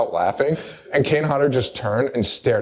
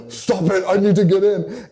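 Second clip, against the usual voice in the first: straining plea; 7 semitones higher than usual. Retold or imitated. Jin straining to get in.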